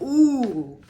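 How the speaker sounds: high, loud delighted ooh; intrigued ooh. Retold or imitated. Recalling reading the inside flap, a delighted ooh. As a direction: react like you just found a treasure. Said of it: "I was like".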